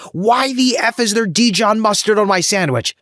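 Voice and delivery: hammed-up enraged customer; retold angry shout. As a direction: imitate the furious caller. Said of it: "I hear somebody shout, literally at full volume".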